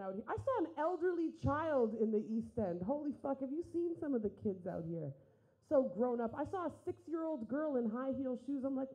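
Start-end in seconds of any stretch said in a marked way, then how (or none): none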